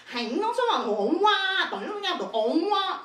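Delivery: hammed-up Cantonese gibberish; mock Cantonese. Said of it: "if you hear them be like".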